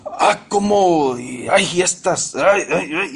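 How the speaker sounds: drawn-out mock grumbling, pitch rising; exasperated tone. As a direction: act out irritated sighs and ays. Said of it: "el tono de voz tiene mucho". (quejas exasperadas, imitadas)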